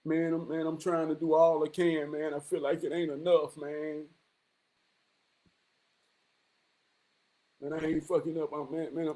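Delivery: low, soft, dejected mumble; low, defeated brother. Retold or imitated. Voicing a struggling brother telling him it ain't enough, dejected and low. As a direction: sound worn down and unappreciated. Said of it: "I've talked to some brothers who were slipping".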